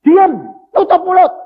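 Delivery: loud, high, slow rebuke; stern hush. Retold or imitated. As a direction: silence her harshly. Disciples hush the woman.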